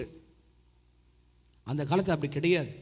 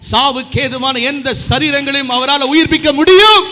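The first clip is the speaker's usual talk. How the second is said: much louder and higher, declaring with power; preaching at full force; 8 semitones higher, 17 dB louder than usual. Real, not acted. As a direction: shout the promise to the crowd. Climax of sermon, loud.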